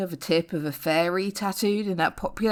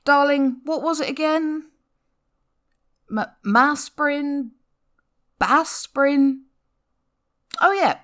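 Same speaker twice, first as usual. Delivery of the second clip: mock forgetful; 8 semitones higher, 3 dB louder than usual. Feigning forgetting the drug's name.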